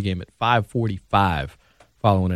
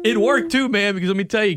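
much higher, eager storytelling; high excited pitch into the story; 11 semitones higher, 3 dB louder, faster than usual. Real, not acted. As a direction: jump in with excitement. Excited story about Pepsi.

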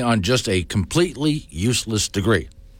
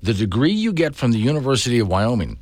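ordinary talk, nothing marked

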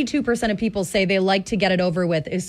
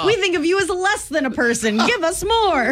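unhinged villain; 7 semitones higher than usual. Acted. Voicing the greedy state back.

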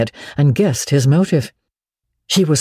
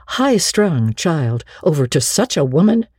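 ordinary delivery, nothing marked

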